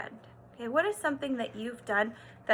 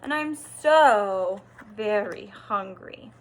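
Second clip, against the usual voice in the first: slow, louder plea from a tiny mouse; Mouse, hungry; 3 semitones lower, 9 dB louder, slower than usual. A narrator's reading. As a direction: drag it out as a hungry little mouse. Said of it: "said Mouse".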